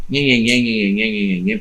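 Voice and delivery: lightly mimicking mealy-mouthed political waffle; mock "nhen-nhen" of evasive small talk; mock waffling. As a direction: run the syllables together as dismissive, meaningless babble. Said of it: "aquela conversa mole".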